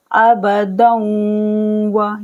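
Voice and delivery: अबदन व with the nun merged into wa with a hum; slow recitation with ghunna; nasal merge. Shown as a demonstration. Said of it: "गुन्ने के साथ मिला के इदगाम करेंगे".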